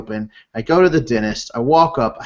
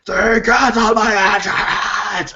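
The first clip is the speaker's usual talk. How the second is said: drawn-out, high-pitched mock rant; Batman voice, furious; 8 semitones higher, slower than usual. Husband raging at the insurance company, Batman-voice rant. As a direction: re-enact losing it in an over-the-top Batman voice. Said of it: "it was Batman voice times ten".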